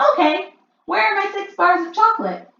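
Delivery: put-on demanding boy. A narrator's reading.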